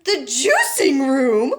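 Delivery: slow, rising disbelief; a mother aghast; shocked cry. A narrator's reading. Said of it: "cried Missus Beauregard".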